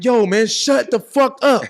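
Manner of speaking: slow, high, loud acted yell; retold screaming. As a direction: stretch it out as an exasperated shout. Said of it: "I'm screaming this at him".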